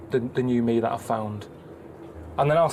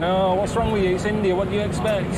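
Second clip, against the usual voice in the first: mock viewer; 5 semitones higher, 4 dB louder than usual. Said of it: "People will be watching it going".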